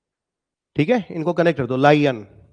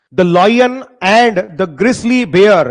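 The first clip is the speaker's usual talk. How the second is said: poem recital; 3 semitones higher, 5 dB louder, much slower than usual. Shown as a demonstration. Teacher reciting poem line again.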